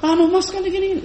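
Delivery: hurried wife. Acted. Wife rushing to unload problems.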